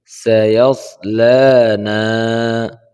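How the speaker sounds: slow melodic tajweed recitation; chanted Quranic recitation, slow and drawn out. Shown as a demonstration. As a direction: recite slowly with a long melodic line. Quran recitation of Surah Lahab.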